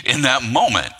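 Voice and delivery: normal talking voice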